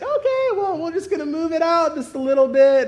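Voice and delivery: put-on high old-lady operator voice; nice old lady. Old lady adjusting the MRI bed, high-voiced. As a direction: imitate the old lady soothing at a high pitch. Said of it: "nice little old lady".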